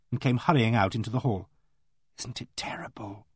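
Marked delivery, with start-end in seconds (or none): 2.2-3.3 very soft, confiding whisper; hushed whisper. A narrator's reading. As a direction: whisper it, keeping it from being overheard. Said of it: "said in a hushed whisper".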